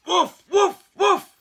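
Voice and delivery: high, loud yapping woofs; barking dog. Acted. Dog arriving among the cats, loud barking. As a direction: bark each woof sharply.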